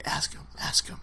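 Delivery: urgent whisper in someone's ear; imitated whisper. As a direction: hiss it like a voice in his headset. Agents whispering in ref's ear.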